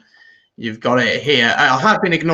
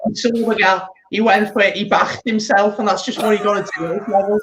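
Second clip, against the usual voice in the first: Scouse football pundit, pitched up; Jamie Carragher Scouse accent; 7 semitones higher than usual. Acted. Mock match analysis for co-host, raised Scouse accent. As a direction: analyse it in a high, put-on Scouse voice. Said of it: "You and Jamie Carragher?".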